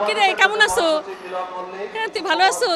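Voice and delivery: loud, hearty Bogura dialect greeting. Shown as a demonstration. Man greets a friend for the camera in Bogura dialect. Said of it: "বগুড়ার ভাষায় বলতে পারি".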